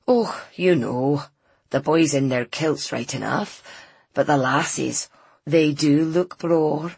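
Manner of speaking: slow, appreciative Scottish character voice; leisurely Scots lilt, drawing out the praise; Scottish doctor. Acted. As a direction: play an admiring Scottish village doctor.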